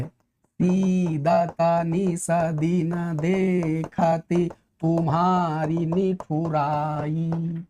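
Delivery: very slow, drawn-out verse; savoured recital of a couplet; slow poem recitation. Shown as a demonstration. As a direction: recite Tulsidas slowly and lyrically. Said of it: "जैसे".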